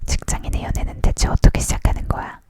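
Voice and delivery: ordinary delivery, nothing marked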